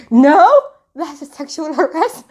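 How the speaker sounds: high, loud mock outrage breaking into laughter; retold outraged protest. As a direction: imitate a scandalised friend, laughing. Friend's protest retold through laughter.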